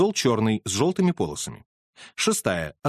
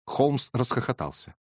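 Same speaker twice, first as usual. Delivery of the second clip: ordinary delivery, nothing marked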